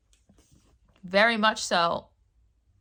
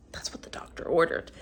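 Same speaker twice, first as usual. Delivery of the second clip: unvoiced hushed aside; whispered aside; 7 semitones lower, 8 dB quieter than usual. Real, not acted. Reader whispering an aside about the book. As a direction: whisper it like a secret.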